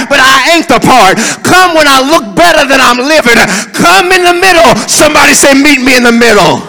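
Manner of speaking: loud, rhythmic preacher's cry; full-force preaching. Real, not acted. Preacher pleading "come" before the congregation, full-force preaching. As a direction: belt out each plea to the congregation.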